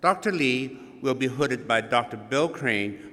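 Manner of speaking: usual speaking voice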